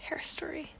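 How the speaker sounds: a hushed aside; whisper. Real, not acted. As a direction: whisper the words under her breath.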